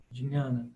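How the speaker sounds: "Dhinhana" as "dji-NHA-na"; classical Sanskrit pronunciation. Shown as a demonstration. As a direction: sound both J and palatal N in "Dhinhana". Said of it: "representa apenas um som". ("Dhinhana" com os dois sons, J e NH)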